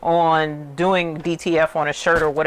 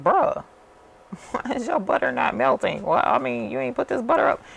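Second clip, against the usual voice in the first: incredulous; 7 semitones higher than usual. Retold or imitated. Retelling disbelief at butter vendor.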